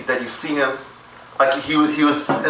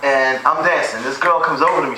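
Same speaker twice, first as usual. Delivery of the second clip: ordinary delivery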